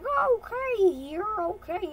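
put-on high, loud, swooping girl voice; tiny-faced girl. Girl answering surgeon's phone number offer, high cartoon voice. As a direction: squeal it out high, then ease down.

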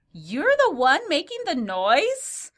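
put-on high, loud, slow old lady in Dracula accent; drawn-out, high-pitched Dracula-style accent. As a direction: ask it slowly in a hammy Dracula accent. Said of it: "great accent if you're going Dracula".

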